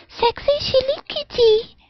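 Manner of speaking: tiny kitten voice, very high; high kitty voice. Acted. Streamer announcing her new Twitter account, in a high kitty voice. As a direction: jump way up into a small-cat voice. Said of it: "the high voice expresses".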